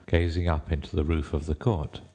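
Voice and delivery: ordinary delivery, nothing marked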